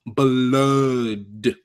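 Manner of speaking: "blood" stretched very long in two beats, buh-LOOD; emphatic. Real, not acted. Streamer correcting that it was no little cut, "blood" heavily drawn out. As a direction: drag out "blood" slowly for emphasis.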